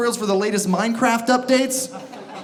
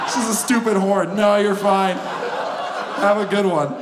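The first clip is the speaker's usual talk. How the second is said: apologetic honker. Acted. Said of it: "you honk and then you're like".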